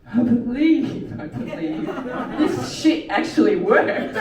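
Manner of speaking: slow, loud revival believer; slow amazed conviction. Retold or imitated. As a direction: proclaim it like a convert. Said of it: "And I'm like".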